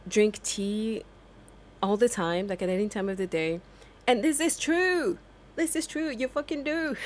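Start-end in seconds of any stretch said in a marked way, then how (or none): 4.1-7.0 much higher and louder, insisting it's true; playful overblown insistence; mock emphatic agreement. Joking to viewers that British people do drink tea.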